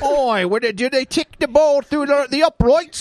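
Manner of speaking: high, exaggerated Aussie caricature; mock Australian accent. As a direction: babble like a cartoon Australian. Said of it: "bad Australian accent was like".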